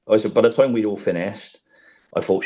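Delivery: usual speaking voice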